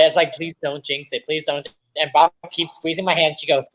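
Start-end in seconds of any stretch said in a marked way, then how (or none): 0.3-1.7 softly muttered, anxious plea; hushed retold pleading; nervous pleading. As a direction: beg under your breath. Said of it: "I was nervous about it".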